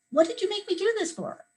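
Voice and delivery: high, indignant complaint to a friend; mock exasperation. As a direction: whine at your friend for dragging you on. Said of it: "I could just say".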